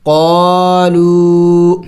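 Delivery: قالو with the و held long; very slow, stretch قالو; قالو, the long vowel drawn out beyond one beat; lengthened vowel. Shown as a demonstration. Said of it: "ایک الف کے مقدار سے تھوڑا سا اور بھی زیادہ".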